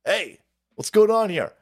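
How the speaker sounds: usual speaking voice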